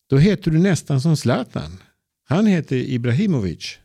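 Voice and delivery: put-on high, eager child's voice; a girl thrilled at the name; excited little girl. A narrator's reading. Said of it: "säger Ebba". (upprymd liten flicka, ljus röst)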